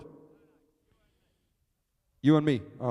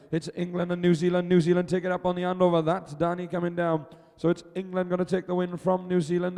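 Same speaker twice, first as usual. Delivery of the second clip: quick, raised live play-by-play; excited live race call; race commentary; 4 semitones higher than usual. Acted.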